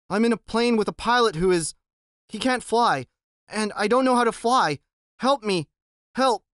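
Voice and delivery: put-on stranded boy begging the radio for help; desperate pleading. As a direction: beg the voice on the radio to save you.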